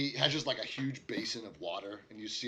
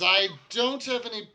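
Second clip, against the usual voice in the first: gruff barkeep, pitched higher, caught off guard; a barkeep flustered at a request he can't fill; bartender voice; 11 semitones higher, 5 dB louder than usual. Acted.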